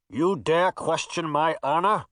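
villain speaking through a helmet; muffled, indignant knight. Acted. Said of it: "a muffled voice said from behind the helmet".

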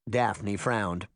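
plain reading voice

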